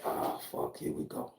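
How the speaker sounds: hushed, breathy oh-no under his breath; whispered dread. Retold or imitated. Comedian whispering his dread at the cop's approach. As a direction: whisper it to yourself like trouble just arrived.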